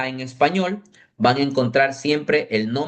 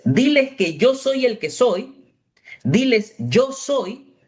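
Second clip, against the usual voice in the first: higher, proclaiming voice of God; 7 semitones higher, slower than usual. Retold or imitated. A hint of God speaking to Moses, raised. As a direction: announce it as God would. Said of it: "lo que le dice Dios a Moisés".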